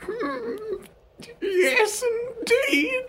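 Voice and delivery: put-on very high, quavery old lady; squeaky elderly wife voice, far above your own; Sherry Ovaltine. Wife agreeing to the getaway, very high old-lady voice. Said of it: "Should I do a voice?".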